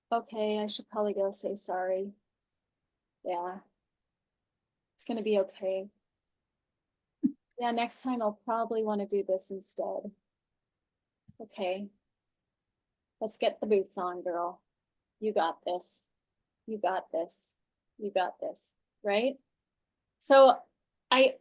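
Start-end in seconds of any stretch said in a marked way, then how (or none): 0.1-11.9 soft, settled self-talk; quiet acceptance after venting; calm self-coaching. Shown as a demonstration. 13.2-19.4 low, soft self-encouragement; hushed, lower pep talk; self-coaching. Shown as a demonstration. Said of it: "I coach myself".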